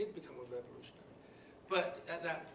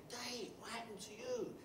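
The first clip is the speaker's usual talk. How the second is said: workers' concerned question; 7 semitones higher, 6 dB quieter than usual. Retold or imitated. Workers greet fired steward.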